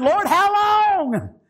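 high, drawn-out cry to God; mock-weary plea. Preacher voicing a tired believer to the Lord, high drawn-out plea. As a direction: stretch it out slowly, high, as a complaint. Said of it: "you're saying".

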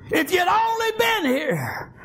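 drawn-out crying plea of a retold sister; impassioned plea. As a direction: cry out the reproach, stretching each word. Sister's plea repeated with force.

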